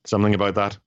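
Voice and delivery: ordinary talk, nothing marked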